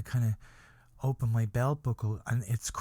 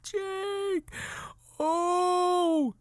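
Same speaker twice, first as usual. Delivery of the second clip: very high, drawn-out fake wail; over-the-top sobbing cry; mock crying. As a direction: howl the name in hammed-up grief. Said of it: "I'm crying".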